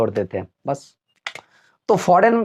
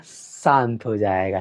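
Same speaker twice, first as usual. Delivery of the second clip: softer, lower, slow hush; hushed and silent; 9 semitones lower, 5 dB quieter, slower than usual. Shown as a demonstration. Teacher hushes to show the silent e, soft and slow. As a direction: go quiet as the letter goes silent.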